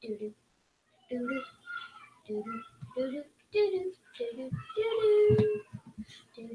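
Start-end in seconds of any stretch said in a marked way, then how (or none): 3.5-5.6 mock sneaky hum.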